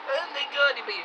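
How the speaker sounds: high wailing plea; mock Putin pleading. Putin begging in torment in hell. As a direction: cry it out like a begging prisoner. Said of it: "screaming and swearing and Russian, begging and pleading".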